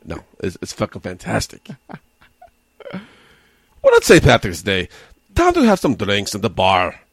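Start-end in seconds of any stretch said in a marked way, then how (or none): 2.9-7.0 hammed-up Irish brogue that drifts toward Scottish; a jokey St Patrick's Day Irish voice, badly done; mock Irish accent. Podcast host jokes for St Patrick's Day in a mock Irish accent. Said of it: "That was Scottish".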